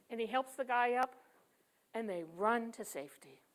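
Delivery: ordinary talk, nothing marked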